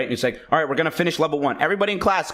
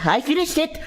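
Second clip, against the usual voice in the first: insistent, higher repeated protest; arguing kid; 7 semitones higher than usual. Retold or imitated. Kid arguing with the teacher, insistent and higher. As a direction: keep insisting, climbing higher each time. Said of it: "I was arguing with this hoe".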